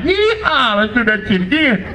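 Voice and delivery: high whooping Southern girl, mock thrilled; hammed-up yeehaw squeal. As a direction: yell it like a thrilled cowgirl. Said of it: "she'd be like".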